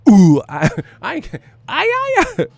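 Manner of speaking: loud "ooh" into squealed ad-libs. Acted. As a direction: hype rapper impression breaking into laughter. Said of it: "I gotta say it like E four".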